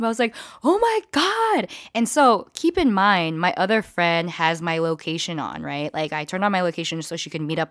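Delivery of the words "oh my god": high, slow stunned gasp. Retold or imitated. Reacting to being at a sex club, high and slow. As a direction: let it sink in, amazed.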